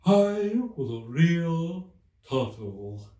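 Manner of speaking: slow, high, loud mournful lament; sighing Mock Turtle. A narrator's reading. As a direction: drag each word out in sorrow. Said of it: "with a deep sigh".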